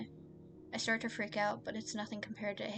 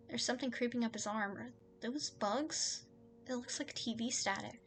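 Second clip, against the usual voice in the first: ordinary talk, nothing marked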